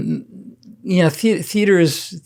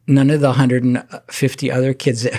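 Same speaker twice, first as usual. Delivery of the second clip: ordinary delivery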